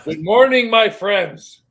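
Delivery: loud booming good morning; hollered greeting. Retold or imitated. Retelling a TikTok video, loud hollered greeting. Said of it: "guy hollers".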